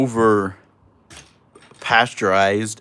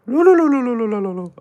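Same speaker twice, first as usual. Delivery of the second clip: sing-song gibberish pitched way up; high mock babble; 12 semitones higher, 4 dB louder, faster than usual. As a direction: babble nonsense like fake Spanish, very high. Fake Spanish babble.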